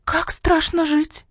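high, slow, drawn-out squeal; quiet squeal. A narrator's reading. Pig wife reacts to husband's story, squealing quietly. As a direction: squeal it softly, stretched out high. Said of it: "тихо взвизгнула Маняша".

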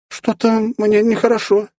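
high, slow, stammering babble; stammering drunk girl. A narrator's reading. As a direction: falter out the words, tipsy. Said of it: "заикаясь, пролепетала Эльнара".

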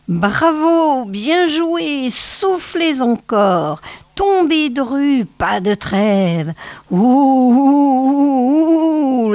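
high, eerie crone wailing at the wind; old snow witch. Acted. Old witch goading her wolves through the blizzard, high eerie howling. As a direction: howl it out like a cruel old witch urging the storm.

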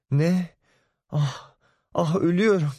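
high, wailing "I'm dying"; mother reeling at shocking news; melodramatic mother. Acted. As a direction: clutch your chest in mock despair. Mother hears daughter slept with him.

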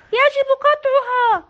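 loud, high يجب قطعها, stress on يجب; crowd shout. A narrator's reading. Villagers agreeing with mayor, loud shout. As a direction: shout it together as a mob. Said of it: "هنا صاح الجميع".

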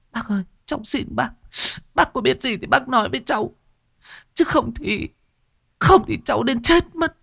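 very high, rising desperate plea; desperate pleading. A narrator's reading. Widow clinging to Ngô's arm, desperate high plea. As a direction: implore him, voice climbing to a cry.

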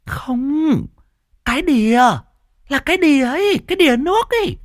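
very high woman spelling it out; high insistent correction. Acted. Mai corrects deaf old Cẩm in a high insistent voice.

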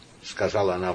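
ordinary delivery, nothing marked

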